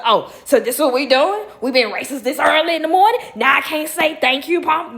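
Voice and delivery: loud, high outraged protest; mock fired-up outrage; indignant inner rant. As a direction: rant it in disbelief. Indignant thought acted out.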